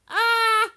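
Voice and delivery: sudden high, loud "ah"; frustrated yelp. Real, not acted. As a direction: let out a sharp yelp of effort. Struggles to make hand shape.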